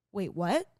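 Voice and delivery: loud, rising, astonished question; can't believe what she just heard; jolted into a loud wait, what; stunned disbelief. Retold or imitated. Retold disbelief at advice.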